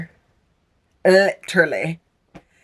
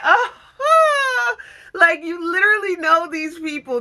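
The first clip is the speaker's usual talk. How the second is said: crying while talking; 9 semitones higher than usual. Real, not acted. Crying over characters.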